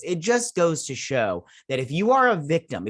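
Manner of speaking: usual speaking voice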